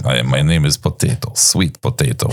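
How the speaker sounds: light mock Russian accent; a hint of Russian accent, playful. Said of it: "Why am I speaking in Russian accent?".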